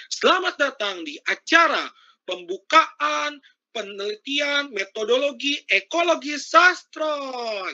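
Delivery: high, drawn-out announcer welcome. Shown as a demonstration. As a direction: open the event like a stage host. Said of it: "kayak misalnya M C". (suara pembawa acara yang lantang)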